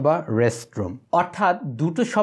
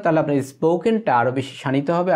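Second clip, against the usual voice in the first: normal talking voice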